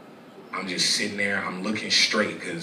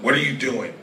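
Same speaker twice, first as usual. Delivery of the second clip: raised, higher voice, breaking off mid-story; startled interruption; 6 semitones higher, 6 dB louder than usual. Real, not acted. Guest interrupts own sad story to challenge someone nearby, raised voice. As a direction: cut in loud and higher, demanding an answer.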